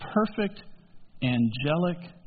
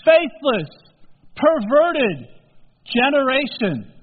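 louder, higher indignant charge; 6 semitones higher, 5 dB louder, slower than usual. Acted. Jesus condemning the generation, indignant charge. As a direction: spit out each word as an accusation.

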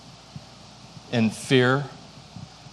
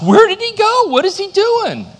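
high, loud searching call; mock frantic calling; 4 dB louder than usual. Searching the house, calling for the hiding toddler, hammed-up high call. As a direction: call out for the hidden boy, playing it up.

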